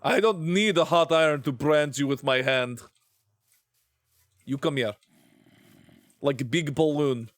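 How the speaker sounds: mock threat in a Russian accent. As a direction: play a stern Russian scolder. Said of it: "Why am I still doing the Russian accent?".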